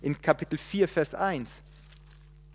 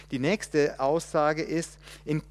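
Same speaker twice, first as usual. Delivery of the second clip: normal talking voice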